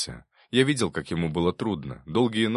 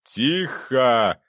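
loud, higher-pitched call for order; judge's shout; 6 semitones higher, 5 dB louder than usual. A narrator's reading. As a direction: silence the courtroom with one raised word. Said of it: "крикнул судья". (окрик судьи, громко призывает к порядку)

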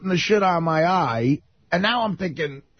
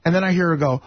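usual speaking voice

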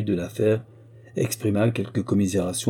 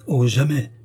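quick, louder, higher cry; eager exclamation; 5 semitones higher, 5 dB louder than usual. Acted. Gardener protesting to the prince, eagerly. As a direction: blurt it out eagerly. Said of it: "s'écria vivement".

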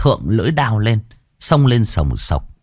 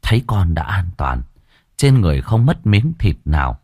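ordinary delivery

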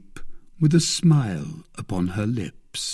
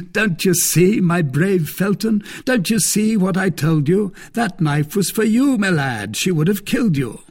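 higher, louder triumphant taunting; gloating Lord de Winter; 7 semitones higher, 4 dB louder than usual. Acted. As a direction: crow over being proven right.

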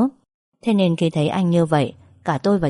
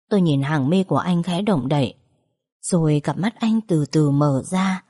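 plain reading voice

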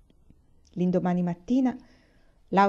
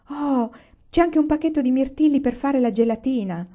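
delighted; 6 semitones higher, 4 dB louder than usual. Acted. Papà finds cranberries too.

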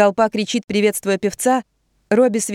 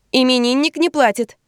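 protesting; 6 semitones higher than usual. Acted. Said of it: "протестует Беа".

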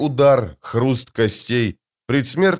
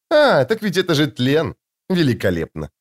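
much higher, light and airy lady's voice; sweet woman's voice; 4 semitones higher than usual. Acted. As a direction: play the serene, otherworldly beauty.

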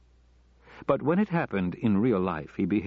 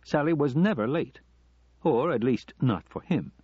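plain reading voice

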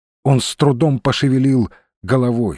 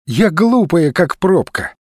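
higher-pitched woman's retort; objecting; 7 semitones higher, 3 dB louder, slower than usual. A narrator's reading. Olga objects to being called guarded, protesting. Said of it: "возразила она".

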